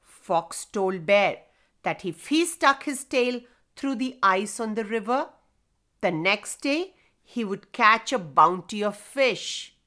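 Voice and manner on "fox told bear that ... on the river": usual speaking voice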